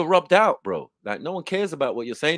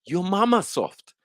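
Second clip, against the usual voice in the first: mama taunt; 7 semitones higher, slower than usual. Shown as a demonstration. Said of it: "but I could say".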